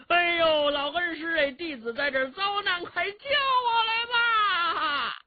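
singsong invocation building to a long, high shout; high calling plea. Acted. Second shouted call for rescue. (拖长声调高喊求救，越喊越高)

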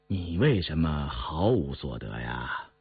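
low, soft fatherly question; emperor questioning. A narrator's reading. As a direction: ask it quietly, pitched well down. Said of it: "转脸问胤禛". (皇帝低声询问)

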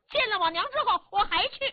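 put-on a young boy digging in his heels; stubborn boy. Boy telling his uncle he will go back, high and stubborn.

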